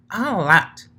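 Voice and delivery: high, bright pony voice; bubbly high character voice; Pinky's voice. Acted.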